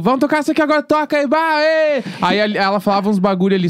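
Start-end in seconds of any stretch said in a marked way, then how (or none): 0.0-2.0 high, lively mock cheer; excited cheering. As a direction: hype up the band. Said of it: "rindo, tocando".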